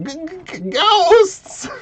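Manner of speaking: stammered high yell of fright; May. Retold or imitated. Game master echoing May's alarm, high stammering mock-scared yell. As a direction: stutter on the first sound, then burst out high. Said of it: "you hear May yell out".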